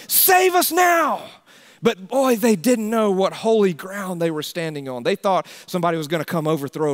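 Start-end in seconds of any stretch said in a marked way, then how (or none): 0.1-1.2 high, loud, slow cry of save us now; shouted plea. Retold or imitated. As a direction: cry out like a desperate crowd. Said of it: "So they're shouting".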